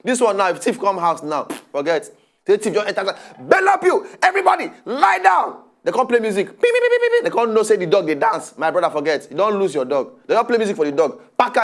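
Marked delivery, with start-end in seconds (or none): 3.4-5.6 high yelled robber's command; thief's shout. Retold or imitated. Thief storming the house, yelling high and loud. 6.5-7.2 very high squeaky beeps; mock music beeps. Mimicking the party music, very high squeaky beeps.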